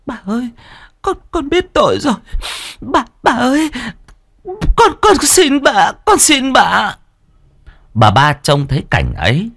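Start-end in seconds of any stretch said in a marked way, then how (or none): 0.1-6.9 high, desperate begging; pleading maid. A narrator's reading. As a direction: beg for mercy, stammering. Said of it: "van xin".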